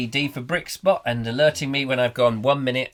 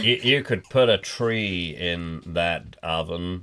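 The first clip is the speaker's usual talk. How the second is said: drawling mascot; 6 semitones lower than usual. Pretend.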